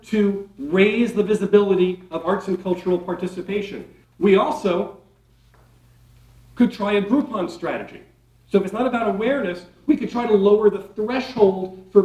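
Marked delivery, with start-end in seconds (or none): none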